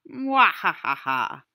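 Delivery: slow, loud villain laugh, hammed up; evil cackle. Storyteller spooking his friends by firelight, hammy evil cackle. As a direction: cackle like a campfire ghoul. Glows menacingly in the hollows.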